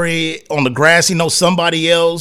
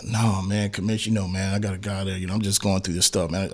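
much softer and lower, mumbled excuse; hushed mock player; 9 semitones lower, 10 dB quieter than usual. Player quietly excusing himself to the commissioner, hushed mumble. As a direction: plead your case under your breath. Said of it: "let you like".

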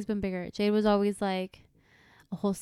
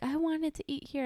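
higher, softer mock whine; imitated crying whine; 6 semitones higher, 5 dB quieter than usual. Child refused a restaurant, imitated tearful whine. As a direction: lightly imitate a kid crying for what they want. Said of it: "and they're crying".

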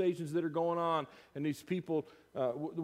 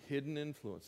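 soft echo; 8 dB quieter than usual. Shown as a demonstration. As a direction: repeat it quietly to yourself. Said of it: "Salt implies hidden influence".

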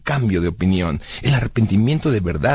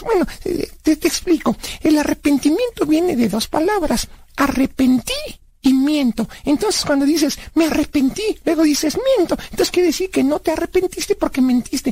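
put-on high-pitched kid character working out a theory; high cartoonish know-it-all; childlike Blas, explaining.

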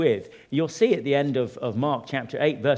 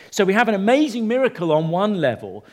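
ordinary talk

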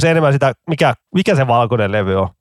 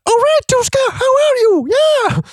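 mock singer's squeaky falsetto, much higher; high-voiced stage banter; slower than usual. Podcaster mimicking the singer greeting the festival crowd, squeaky falsetto. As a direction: ham up the frontman's high-pitched crowd greeting. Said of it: "kun se veti välispiikit tuolla korkealla äänellä".

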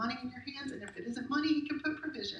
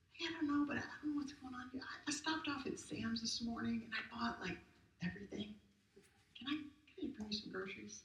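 softer, tentative visitor at the door; hesitant neighbor; 8 dB quieter than usual. Retold or imitated. Neighbor at the door offering groceries, hesitant and soft. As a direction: offer the groceries shyly, softly. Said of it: "And a knock on the door".

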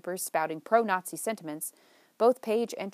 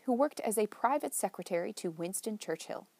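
plain reading voice

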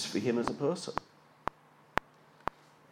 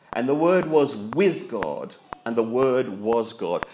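slow solemn reading; slower than usual. Acted. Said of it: "slow solemn reading".

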